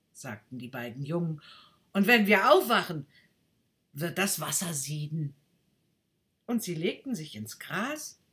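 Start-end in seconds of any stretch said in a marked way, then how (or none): 1.9-5.3 louder and fast at first; lazy plan to nap; boy voice. Acted. Boys say they will sleep until the water boils, louder.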